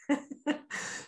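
short breathy chuckle; quick real chuckle; amused laugh. Real, not acted. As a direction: laugh softly at your own joke. Laughs at own acted bit.

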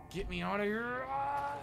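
high yell climbing into a scream; panicked cry. Pretend. Player trapped in the pod, panicked cry. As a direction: wail upward to be let out.

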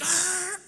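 long, high "aah" held on one note; exasperated yell. Retold or imitated. Driver cutting off a passenger's gossip, exasperated high cry. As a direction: drown him out with a high mock scream.

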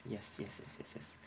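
rapid yeses under the breath; hushed little celebration; whispered yes. Real, not acted. Quiet celebration while playing.

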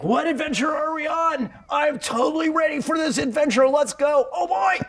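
high, eager puppy voice, almost shouting; a dog thrilled for any adventure; excited dog voice. Retold or imitated. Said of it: "they're gonna be like".

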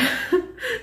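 high, rising giddy giggle; a flustered little burst of laughter. Real, not acted. Laughing at ending the video.

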